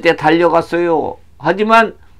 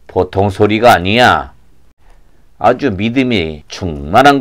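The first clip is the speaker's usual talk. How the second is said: deep, composed voice; calm, authoritative Jesus; 7 semitones lower than usual. Acted. Jesus sending disciples for the blind man, calmly authoritative. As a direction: instruct gently from a low register.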